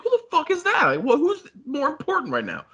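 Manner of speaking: high-pitched incredulous questioning; acted indignant demand. Imagining confronting a partner over the phone call, indignant and high.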